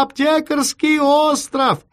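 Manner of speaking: high, drawn-out yell of "Аптекарский остров"; shouting. A narrator's reading. Chief giving the address by telephone, slow high shout of "Аптекарский остров". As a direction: shout "Аптекарский остров" as one running phrase. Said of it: "и закричал ещё громче".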